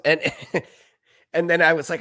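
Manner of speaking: ordinary delivery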